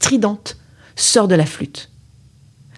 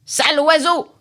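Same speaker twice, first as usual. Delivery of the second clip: sorceress yelling; 8 semitones higher than usual. Acted. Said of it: "hurle la magicienne".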